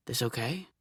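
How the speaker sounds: soft, hushed check-in; softly. A narrator's reading. Lover softly checking in during an intimate moment, hushed. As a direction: ask it gently under your breath. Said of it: "he says softly".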